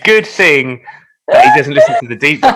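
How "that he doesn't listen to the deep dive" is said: words squeezed out laughing. Real, not acted. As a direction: laugh through the line.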